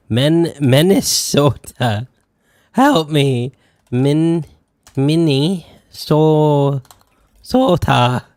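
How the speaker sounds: loud, slow, syllable by syllable; exasperated loud sounding out of Minnesota; frustrated sounding out. Real, not acted. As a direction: struggle to spell it out loud, begging for help. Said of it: "I feel like I've been lobotomized".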